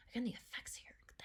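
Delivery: awed whisper. Real, not acted. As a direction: breathe it out in a whisper. Awed at the visual effects.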